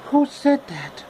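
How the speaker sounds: put-on high startled girl voice. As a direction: jump at a hidden voice.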